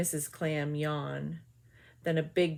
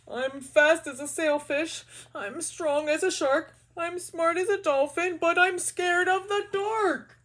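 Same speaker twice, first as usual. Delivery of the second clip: higher and louder, building up; squeaky climbing fish boast; anxious boasting fish; 9 semitones higher, 4 dB louder than usual. Acted. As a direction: brag louder and louder to beat the fear. Mister Fish boasts, rising.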